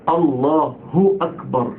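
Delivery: الله ending in a clear "hu" before أكبر; correct damma on ha. Shown as a demonstration. Teacher correcting the muezzins' first mistake, careful pronunciation. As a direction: say "Allaa-hu", a short break, then أكبر. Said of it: "اله مضمومة كده".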